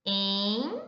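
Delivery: "Em" stretched out by itself; lone, lengthened "Em"; isolated syllable. Shown as a demonstration. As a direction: draw out "Em" as you write it. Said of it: "a prof vai escrever aqui".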